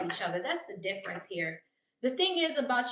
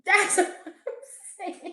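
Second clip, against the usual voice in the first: laughing; 8 semitones higher, 8 dB louder, much slower than usual. Real, not acted. Laughing at the haters.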